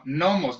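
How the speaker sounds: "gnomos" said as "nomos"; silent G. Shown as a demonstration. Host correcting his friend's pronunciation, silent G. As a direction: start "gnomos" straight on the n, G left silent. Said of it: "no se pronuncia así".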